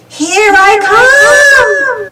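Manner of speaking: very slow, high, loud, wailing ghost; ghost moan. A narrator's reading. Ghost swooping at Pablo, long moaning wail. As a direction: draw it out, moaning and eerie. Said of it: "she moaned".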